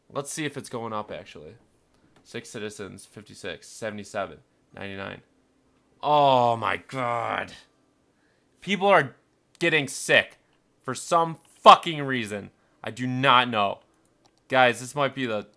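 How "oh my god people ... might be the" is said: loud, raised-voice frustration; loud, emphatic alarm; exasperated dismay. Real, not acted. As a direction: exclaim loudly as things fall apart. Gamer alarmed at sick count rising.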